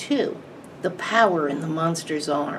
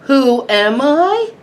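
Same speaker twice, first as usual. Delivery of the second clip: much higher, louder and slow, a giant's roar; booming monster voice; 8 semitones higher, 10 dB louder, slower than usual. A narrator's reading. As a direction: let it swell into a slow, towering boom. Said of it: "its voice getting louder".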